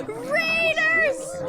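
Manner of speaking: high, loud gasped cry; surprised shout. Real, not acted. Streamer seeing a raid arrive, a loud delighted shriek.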